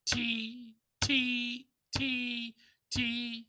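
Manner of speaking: "ti" struck at the front, then easing off; accented syllables. Shown as a demonstration. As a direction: articulate each "ti" like an accented note. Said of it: "I would use the syllable ta or ti".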